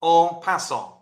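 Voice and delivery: en passant with a light French accent, nasal final vowel in passant. Shown as a demonstration. Teacher tries French accent on en passant for class. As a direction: say en passant the French way, a touch put on. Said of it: "excuse my French accent".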